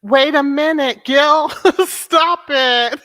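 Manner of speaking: loud tickled stop it; loud laughing protest. Real, not acted. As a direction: call out through laughs.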